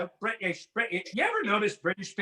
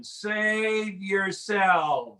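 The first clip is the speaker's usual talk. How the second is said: very slow, drawn-out plea; too weak to speak; much slower than usual. Acted. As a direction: stretch each word out as if starving. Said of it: "I can't even speak".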